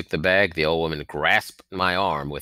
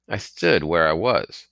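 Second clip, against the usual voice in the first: ordinary delivery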